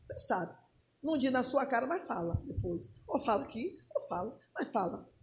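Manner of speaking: low, hushed insinuation; confidential aside. Real, not acted. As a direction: murmur it as if passing on gossip. Hints at gossip behind backs.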